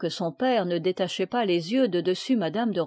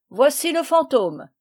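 triumphant; 7 semitones higher, 6 dB louder than usual. A narrator's reading. Father triumphant at ghost capture.

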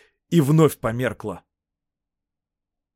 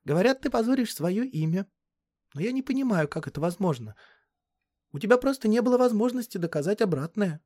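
put-on high-pitched, earnest girl's voice; earnest young girl; 9 semitones higher than usual. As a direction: voice a serious girl challenging an older man. (высокий голос серьёзной девочки)

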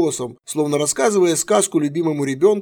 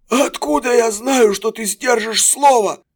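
eager; 8 semitones higher, 4 dB louder than usual. A narrator's reading. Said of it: "жадно спросил пленник".

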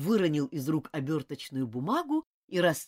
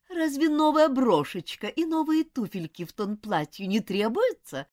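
higher, mincing mimicry of a lady; mock the affected companion; funny thin voice; 8 semitones higher, 5 dB louder than usual. A narrator's reading. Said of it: "забавным тоненьким голосом".

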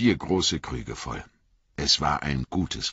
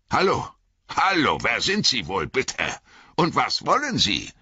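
higher-pitched, demanding farmer; who goes there, in a raised voice; wary farmer challenging strangers; 8 semitones higher than usual. A narrator's reading. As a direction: call out sharp questions to intruders. Farmer challenges strangers at his gate.

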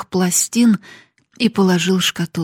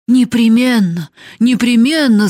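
higher, louder insistence; servant's shout; 5 semitones higher, 7 dB louder than usual. A narrator's reading. Servant backing the daughter against the master, shouting. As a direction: shout it out emphatically. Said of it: "закричала Нанетта".

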